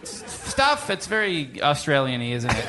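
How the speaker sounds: put-on mild British host accent; John Oliver impression. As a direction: ramble like a TV presenter. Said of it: "give me your best John Oliver impression".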